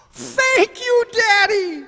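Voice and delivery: put-on overjoyed boy thanking his dad; delighted child. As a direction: squeal it like a thrilled little kid. Son thrilled by ten dollars.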